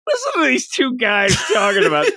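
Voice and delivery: high put-on listener voice; mocking listener. Host imitating people listening to the show, high mocking voice. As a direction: pitch it up as a teasing impression of the audience. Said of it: "people listening are like".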